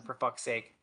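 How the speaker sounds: ordinary talk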